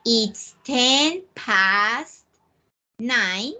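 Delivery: "It's ten past nine" said very slowly, word by word; slow model sentence. Shown as a demonstration. Teacher modelling the time phrase slowly for students. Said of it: "You may say".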